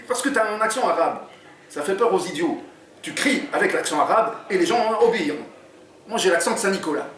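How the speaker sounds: lively read-aloud narration; story reading. A narrator's reading. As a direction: read the chapter to draw listeners in. Author reads his book aloud.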